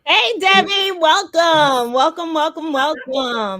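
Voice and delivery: high, loud, effusive greeting; excited welcome. Real, not acted. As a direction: call out a big welcome to a guest. Host calls out welcome to guest.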